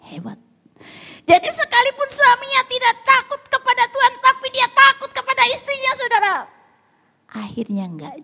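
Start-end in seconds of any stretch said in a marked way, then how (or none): 1.3-6.4 high, loud preaching shout; triumphant punchline. Real, not acted. Preacher summing up the husband's fear to congregation, high emphatic shout. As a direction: drive the punchline home at full volume.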